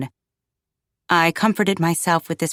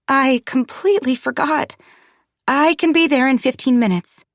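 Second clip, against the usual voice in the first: higher-pitched, flustered and apologetic; flustered apology; 7 semitones higher than usual. Acted. Mother who forgot the appointment assures receptionist, flustered. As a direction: plead as if caught out, pitched up.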